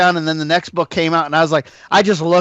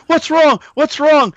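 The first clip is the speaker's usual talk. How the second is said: higher, urgent worried questions; alarmed husband; 10 semitones higher, 3 dB louder, slower than usual. Retold or imitated. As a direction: ask in sudden alarm, pitched up. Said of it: "He's like".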